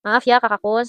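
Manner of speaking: ordinary talk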